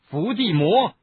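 high, loud burst of anger; angry shout. A narrator's reading. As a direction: shout the name in exasperation. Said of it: "哈利气愤地喊道".

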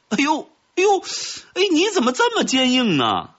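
high-pitched pained yelps and whine; yelp, then whine in baffled complaint; complaining. A narrator's reading. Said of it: "听到黄蜂的抱怨声". (尖声哎呦着抱怨)

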